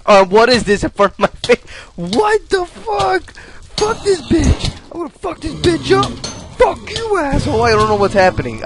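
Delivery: high-pitched shrieking outburst of swearing; panicked screaming. Real, not acted. Gamer yelling at a glitch in front of his face, panicked screaming. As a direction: scream it in shocked outrage, very high and loud.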